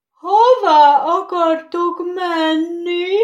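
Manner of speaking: high, loud, slow yawned question; yawning. Acted. Sleepy rocking horse just woken, yawning. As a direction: ask through a big yawn. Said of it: "nagyot ásítva".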